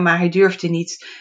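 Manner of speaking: plain reading voice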